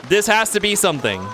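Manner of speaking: usual speaking voice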